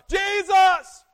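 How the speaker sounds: full-throated high shout of the name. Retold or imitated. Blind Bartimaeus screaming over the crowd at Jesus, loudest shout. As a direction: scream it with everything, desperate to be heard. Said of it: "So he screamed louder".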